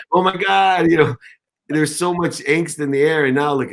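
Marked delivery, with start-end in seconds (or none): 0.1-0.9 imitating nervous panic. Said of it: "you were so nervous".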